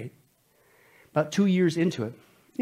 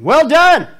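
excited praise; 11 semitones higher, 14 dB louder than usual. Acted. Voicing the master's praise.

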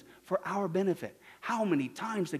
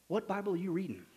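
ordinary delivery

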